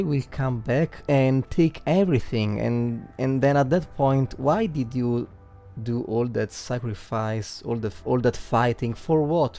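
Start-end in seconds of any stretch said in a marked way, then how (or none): none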